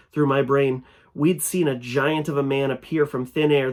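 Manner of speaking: ordinary delivery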